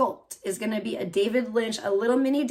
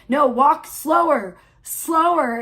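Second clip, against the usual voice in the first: louder, higher calling for slower; imagined director; 4 semitones higher, 7 dB louder, slower than usual. Retold or imitated. David Lynch imagined directing actors, a mock call. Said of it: "I could just imagine, like, David Lynch directing".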